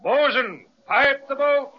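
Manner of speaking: high, sustained shipboard call; calling an order. Acted. Captain orders his bosun, calling out high. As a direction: sing out the order across the deck.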